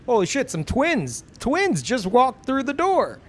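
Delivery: high, loud mock amazement; hammed-up shock, swooping way up; astonished clerk. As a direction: exclaim like a clerk witnessing a marvel. Exaggerated replay of clerk's amazement.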